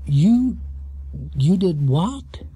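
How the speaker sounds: the smile freezing; shocked stammer. A narrator's reading. Quizmaster stunned by the bear's answer, halting and slow. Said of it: "he exclaimed".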